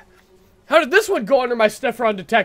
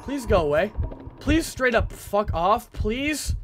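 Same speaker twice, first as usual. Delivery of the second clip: softer plea after the shouting; weary pleading; 4 dB quieter, slower than usual. Real, not acted. Gamer begging Granny to leave, weary pleading. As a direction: beg politely, worn out from yelling.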